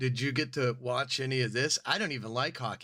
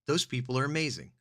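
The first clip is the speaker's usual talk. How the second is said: usual speaking voice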